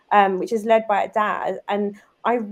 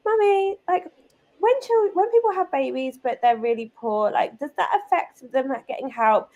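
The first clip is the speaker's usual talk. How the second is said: put-on higher, childlike questioning voice; daughter's voice; 5 semitones higher than usual. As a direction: play the curious little girl asking mum. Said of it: "And she's like".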